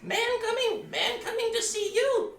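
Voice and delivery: very high, squawky chicken voice. Pretend. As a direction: cluck the words out like a chicken.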